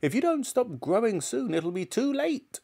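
put-on high, warning old-woman voice; scolding grandmother. A narrator's reading. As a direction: warn the boy as a cross granny.

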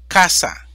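casa dicho fuerte, golpe en CA; ejemplo enfatizado. Shown as a demonstration. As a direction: marca fuerte la sílaba CA de casa. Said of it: "palabras llanas o graves sin tilde". (casa, con fuerza en CA)

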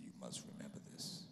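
deep grave guard. Retold or imitated. Guard warning the comic, low and solemn. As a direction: drop the voice low and ominous.

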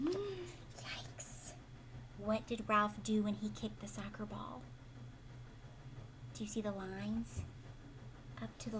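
put-on breathy conspiratorial whisper to the kids; hushed whisper. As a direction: whisper it like a secret. Dramatic aside to listening children.

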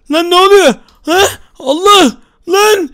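loud startled yelps; a mock frightened yell; alarmed shout. Transforming into Enderman.

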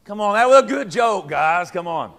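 loud, high mock plea for a laugh; playful protest. Real, not acted. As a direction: push the room to laugh at the joke. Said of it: "That's what I'm saying".

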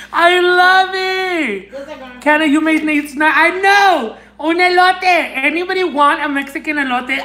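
high, excited exclamations; overjoyed gushing. Real, not acted. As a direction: gush with pitch held way up. Thrilled by corn sticker.

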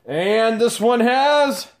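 high, loud, drawn-out reveal; suspenseful build-up. Pretend. Host building suspense before revealing a purse's contents, drawn-out excited announcement. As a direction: stretch it out like a big announcement.

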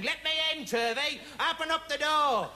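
high-pitched demanding call from outside; mimicked caller demanding to be let in; voice at the door. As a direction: call insistently through a closed door, pitched well up. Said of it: "this voice saying".

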